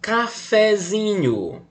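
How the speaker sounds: "cafezinho" slow and high, leaning on "zi"; slow, high "cafezinho" with the stress on "zi". Shown as a demonstration. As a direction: draw out "cafezinho" and hit "zi". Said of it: "Qual é o mais forte agora?".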